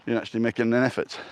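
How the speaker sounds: normal talking voice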